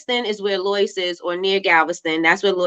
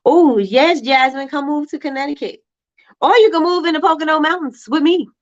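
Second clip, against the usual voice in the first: excited invite; 8 semitones higher than usual. Real, not acted. Inviting viewer to move.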